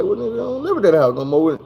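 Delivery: blunt, matter-of-fact mom; lightly voiced mother shrugging it off; a hint of mama. Mama's blunt reply voiced.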